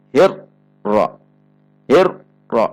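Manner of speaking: "Hirro" stopped abruptly on the r, gap before "ro"; jerked, cut-off r. Shown as a demonstration. Example of a wrong jolt, r cut off. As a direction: break "Hirro" hard at the r. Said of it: "dihentak membacanya seperti huruf syiddah".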